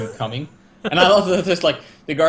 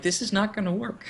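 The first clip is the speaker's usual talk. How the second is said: doubtful mock reply, higher and softer; Aaron; 5 semitones higher, 5 dB quieter than usual. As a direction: sceptical imitation through amusement. Acts Aaron's doubting reply, laughing.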